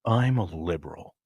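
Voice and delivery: low mocking impersonation; Alec Baldwin. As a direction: imitate an actor's low, measured declaration. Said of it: "And he's like".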